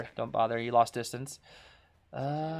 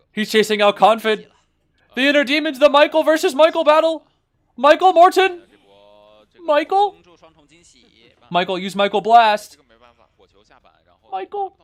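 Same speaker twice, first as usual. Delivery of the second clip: loud, high, excited yelling at the screen; frantic hype calling to the player; excited shouting; 9 semitones higher, 11 dB louder than usual. Real, not acted. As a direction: cheer the player on at the top of your voice.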